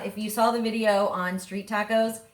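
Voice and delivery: ordinary delivery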